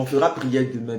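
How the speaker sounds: ordinary talk, nothing marked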